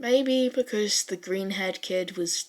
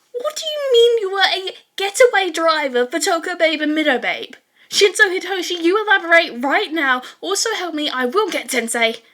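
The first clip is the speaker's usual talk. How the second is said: much higher and louder, yelling in disbelief; loud high outraged yell at a classmate; shocked shout; 9 semitones higher, 10 dB louder than usual. A narrator's reading. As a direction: shriek it at the friend, demanding answers.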